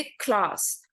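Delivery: क्लास in a British accent, with a long open a as in klaas. Shown as a demonstration. Teacher showing students the British way to say it, in a British accent. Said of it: "British होंगे ना, वो बोलेंगे".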